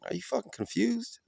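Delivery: imitating Rubio, pitched high and breathy-soft; mock incredulous high voice. Vlogger imitating Rubio mocking the moderator, high incredulous. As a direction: scoff the question way up high. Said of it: "Marco Rubio was like".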